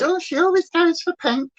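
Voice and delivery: high, teasing mock viewer voice; mimicking a viewer. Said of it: "who said".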